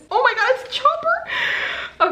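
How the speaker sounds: high, slow, drawn-out squeal of delight; melting over the Chopper tape; excited squeal. Real, not acted. Finding Chopper in the gift from Gavin, squealing with delight.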